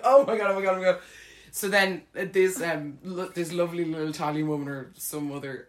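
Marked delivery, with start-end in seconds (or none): 0.0-1.0 loud, repeated alarmed exclamation; comic alarm, raised and loud; retold panic. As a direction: babble it in acted horror. Retold panic during the vomiting.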